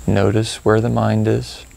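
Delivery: calm, spacious phrases with long pauses; gently prompt, leaving room to notice each feeling; guided meditation. Acted. Guide leading listeners to reflect on procrastination, calm meditation delivery.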